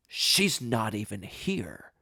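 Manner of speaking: slow, drawn-out disbelief; incredulous judge. Retold or imitated. Judge looking at defendant like an idiot, slow disbelief. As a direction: imitate a judge stating the obvious, very slowly.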